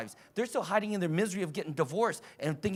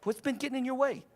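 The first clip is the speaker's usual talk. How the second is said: gentle, higher, caring question; tender coaching question, no force; compassionate; 5 semitones higher than usual. Shown as a demonstration. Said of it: "be compassionate, loving".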